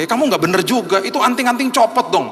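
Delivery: scolding rebuke. Retold or imitated. Preacher rebuking his friend about earrings, high and loud. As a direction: give him a sharp scolding. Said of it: "saya juga tegur dia".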